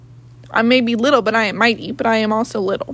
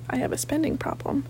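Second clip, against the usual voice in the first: much softer, sheepish confession; sheepish mutter; 3 semitones higher, 10 dB quieter than usual. Real, not acted. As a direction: admit it quietly, embarrassed.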